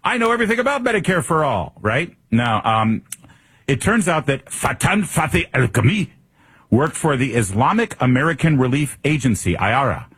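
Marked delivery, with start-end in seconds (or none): 0.0-1.7 high, put-on boastful candidate; mocking high-pitched candidate impression. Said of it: "You'd think that he would say". 4.5-6.1 higher mock Arabic accent; showy Arabic-accented name; exaggerated Arabic pronunciation. Shown as a demonstration. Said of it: "I wanna pronounce it properly".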